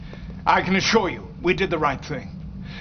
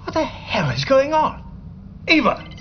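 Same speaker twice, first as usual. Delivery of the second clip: shocked disbelief; 9 semitones higher than usual. Acted. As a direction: pitch jumps much higher, stunned and alarmed. Field marshal caught off guard.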